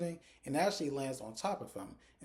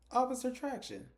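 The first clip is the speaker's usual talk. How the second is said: retold call, pitched way up; 13 semitones higher, 3 dB louder than usual. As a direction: summon the officer in a high, carrying voice. Character calls the officer in.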